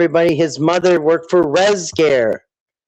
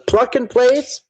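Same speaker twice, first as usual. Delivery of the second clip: doubtful question; 7 semitones higher, slower than usual. Shown as a demonstration. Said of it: "Something like pluck and place".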